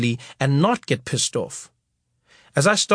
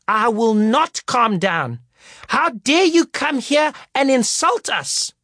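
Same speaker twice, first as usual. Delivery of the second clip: livid teacher; 8 semitones higher than usual. Retold or imitated. Teacher scolding the performers, mock outraged. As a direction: play a furious teacher, dragging words out. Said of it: "teacher arguing back".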